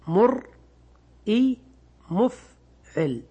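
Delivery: مرئي then مفعل spoken very slowly, syllable by syllable; match مرئي to مفعل slowly; scale pattern drill. Shown as a demonstration. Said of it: "تقول على وزن مفعل". (نطق بطيء جدا لمرئي ومفعل)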